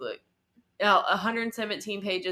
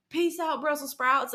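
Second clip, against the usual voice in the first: a high, jaunty peace out; cheeky mock goodbye; breezy sign-off; sassy farewell; 7 semitones higher than usual. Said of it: "she's like".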